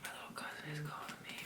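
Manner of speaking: quick whispered aside; hurried whisper. Real, not acted. Vlogger whispering to viewers as her cousin calls. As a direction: murmur it fast under your breath.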